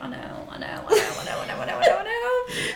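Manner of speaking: whispery repeats climbing to a high squeak; mortified. Retold or imitated. Admitting the embarrassing moment to friends, mortified, rising in pitch. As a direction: build from breath to a high, laughing wail.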